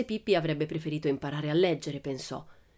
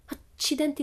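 high hammed-up gasp of alarm; mock frightened cry; 10 semitones higher than usual. Pippi crying out in mock fright at the teacher. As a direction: feign horror at the slip. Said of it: "gridò spaventata".

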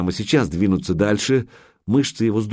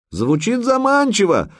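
shouting back; 12 semitones higher than usual. A narrator's reading. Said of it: "прокричал в ответ Санёк".

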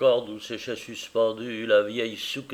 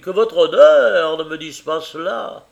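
a beggar voice pitched high; pleading beggar; 3 semitones higher, 8 dB louder than usual. Retold or imitated. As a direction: beg him humbly and loudly. Caleb voicing the beggar.

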